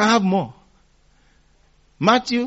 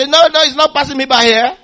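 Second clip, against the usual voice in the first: loud, high retold cry of Zacchaeus; shout; 8 semitones higher, 9 dB louder than usual. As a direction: shout it out over the crowd. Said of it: "He shouted".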